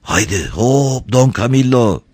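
anxious. A narrator's reading. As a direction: draw out the heave-ho with worry. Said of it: "oldukça endişeli bir ses". (endişeli bir sesle)

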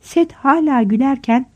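ordinary delivery

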